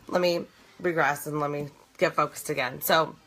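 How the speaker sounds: usual speaking voice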